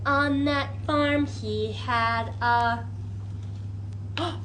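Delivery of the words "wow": usual speaking voice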